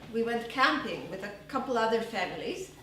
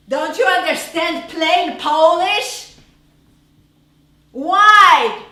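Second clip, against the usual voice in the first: loud exasperated teacher shouting; furious choir teacher yelling at a pupil; angry scolding; 5 semitones higher, 15 dB louder, slower than usual. Retold or imitated. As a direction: bark at the child who can't sing.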